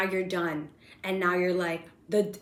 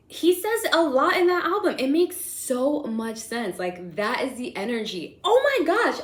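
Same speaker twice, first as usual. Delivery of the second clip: excited gush; 6 semitones higher, 6 dB louder than usual. Real, not acted. Excited gush over the album.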